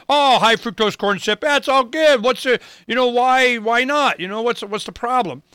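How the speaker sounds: high, breezy mock-innocent salesman voice; mock industry propaganda. Mocking the agricultural industrial complex shrugging off corn syrup concerns, high and breezy. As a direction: play the food lobby brushing off worries with fake cheer. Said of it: "propaganda of".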